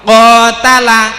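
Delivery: "qatala" drawn out loud, with the ka held long; long vowel demo. Shown as a demonstration. Teacher quizzes pupils on the long vowel in qatala. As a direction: stretch "qatala" so the long a is heard. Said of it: "Saya ambil contoh".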